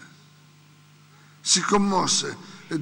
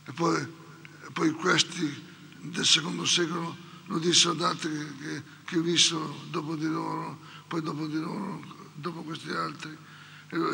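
ordinary talk

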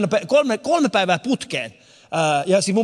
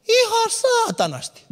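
mock squeak, much higher and slow; high squeaky aside; slower than usual. Comic telling the crowd, high slow squeak. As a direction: squeak it out as a silly punchline. Punchline in put-on voice.

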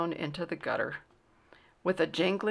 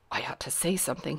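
soft, quick, low inner voice; hushed, hurried thought; thinking to himself; 4 semitones lower, 6 dB quieter, faster than usual. A narrator's reading. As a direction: murmur it as a private thought. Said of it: "he thought to himself".

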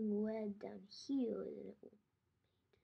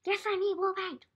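calling out; 8 semitones higher, 6 dB louder than usual. Real, not acted. Answers dad loudly.

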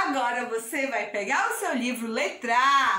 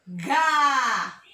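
GA held long on the vowel; drawn-out syllable; 3 dB louder than usual. Shown as a demonstration. Teacher joins G and A for children, drawn out. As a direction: stretch out "ga" as a model.

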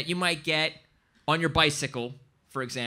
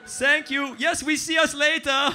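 put-on high, loud German accent; hammed-up German-accented farewell; German-accented English; 9 semitones higher, 4 dB louder than usual. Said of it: "just for the shitty English".

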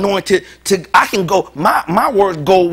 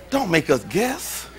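slow plea; 6 dB quieter, slower than usual. Real, not acted. Repeated plea, slowed.

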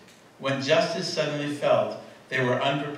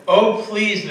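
begging; 6 semitones higher, 6 dB louder than usual. Retold or imitated. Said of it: "they begged".

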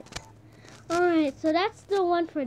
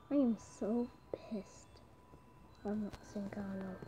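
whisper; 9 semitones lower, 18 dB quieter than usual. Real, not acted. Gamer whispering to himself, irritated. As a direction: breathe it out, annoyed and low.

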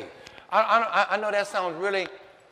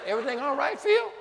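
wife's question; 8 semitones higher than usual. Retold or imitated. Wife asking husband from bed.

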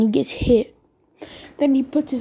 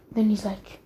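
plain reading voice

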